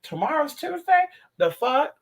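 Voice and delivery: high, incredulous squeal; shocked disbelief. Retold or imitated. Hearing it is Tuesday tomorrow, high shocked disbelief. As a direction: hit it like the news floors you. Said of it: "And I'm like".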